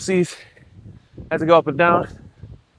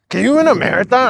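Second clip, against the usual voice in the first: put-on eager kids voice; 7 semitones higher, 5 dB louder than usual. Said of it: "They're like".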